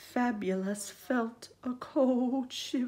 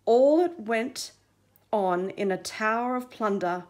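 ordinary delivery

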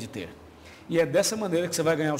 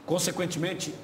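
ordinary delivery

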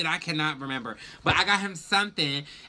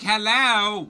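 louder, higher hello with attitude; pointed real hello; 4 semitones higher, 5 dB louder than usual. Retold or imitated. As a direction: say hello like you mean business. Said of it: "Like, real hello".

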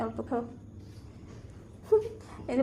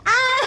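high, loud "ah"; excited squeal; 11 semitones higher, 6 dB louder than usual. Real, not acted. Parent exclaiming over the birthday gift, squealing. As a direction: cry out in a short high burst.

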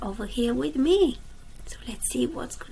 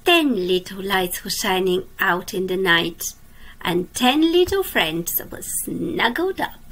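lively storybook reading for little ones; reading to children; 2 semitones lower, 6 dB louder, slower than usual. A narrator's reading. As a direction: read it like a bedtime picture book. Reads a picture book aloud.

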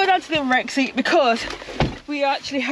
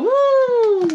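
excited woo; 13 semitones higher, 3 dB louder than usual. Real, not acted. Excited about new pans.

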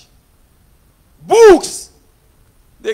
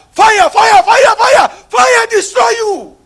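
loud, higher chant of fire, hammered again and again; fire prayer shout; 22 dB louder, slower than usual. Real, not acted. Preacher calling down fire on the devil, shouting.

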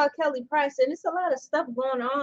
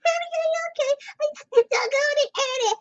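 much higher squeaky singing; chipmunk voice; 10 semitones higher, faster than usual. Pretend. Mocking the chipmunk singers at the song's end, high. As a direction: sing it like a sped-up chipmunk. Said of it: "sounding like chipmunks".

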